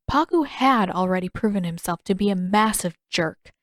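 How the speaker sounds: plain reading voice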